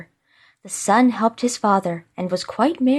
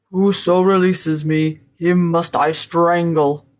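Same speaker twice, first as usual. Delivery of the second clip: awful spirit voice; 3 semitones lower, 3 dB louder than usual. A narrator's reading. Mighty Mercurius threatens his rescuer, awful voice. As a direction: pronounce a doom in a dreadful monster voice. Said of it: "said the spirit".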